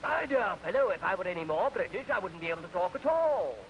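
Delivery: put-on an English gentleman offended at being asked, in his accent; indignant British toff. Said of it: "Man looked up and said".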